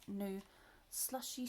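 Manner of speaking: ordinary delivery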